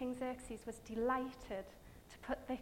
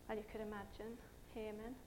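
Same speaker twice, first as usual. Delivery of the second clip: ordinary delivery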